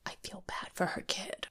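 barely voiced, imitated whisper; hushed mimicry, no voice at all; mock whisper. Mimics Heather's hushed sympathy.

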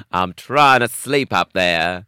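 loud, slow mock yell at the noisy downstairs; mock neighbour yell. Podcast host playing an angry neighbour, loud slow mock yell. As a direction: bellow it up at the neighbours in fun.

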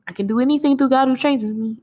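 rattled off fast, a mock kid's dinner-table recitation; rushed recitation. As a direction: race through the verse like a hungry kid. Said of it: "we shouldn't have been saying it that quick".

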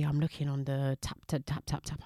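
usual speaking voice

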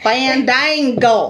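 drawn-out country Fandango; country accent. Real, not acted. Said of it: "Why you say it so country?".

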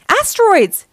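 high, loud warning cry; alarmed shout. A narrator's reading. Donnie spots space boulders, alarmed shout. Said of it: "shouted Donnie".

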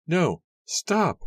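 very high, loud shout; sudden high-pitched alarm; alarmed cry. A narrator's reading. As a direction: cry out to halt someone at once. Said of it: "her mother cried".

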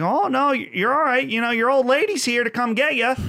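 put-on falsely cheerful, as if comforting a drunk; mock polite Canadian. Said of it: "And they're like".

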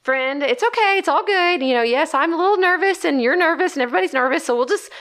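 high nervous sweet-talk to a snake; nervous coaxing. Retold or imitated. Gardener coaxing a snake out of her potato bed, high and nervous. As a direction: coax it away, voice up high with nerves.

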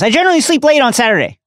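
high, quick grumbling protest; grumpy Slughorn. A narrator's reading. Professor woken on his day off, grumpy. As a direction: complain fast and high, put out. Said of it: "he's not happy".

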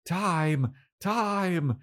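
high mock scream without the volume. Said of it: "She starts screaming".

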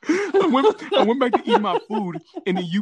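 high, laughing through the words. Real, not acted. Recalling going back to his noodles, laughing. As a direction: crack up while telling it.

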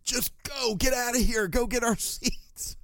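high, slow mock urging of a friend; urging friend quote. Friend pushing him toward the seats, in a high, slow mock voice. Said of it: "He's like".

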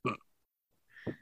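faint strangled ugh; a quiet choked grunt; barely voiced choking sound; mock choking groan. Workman choking.

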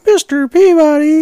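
put-on drawn-out childish whine; Sherman voice. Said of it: "then Sherman would go".

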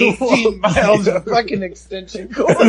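high, loud cartoonish voice. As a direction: play it like a wacky cartoon. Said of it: "I went straight cartoon on that shit".

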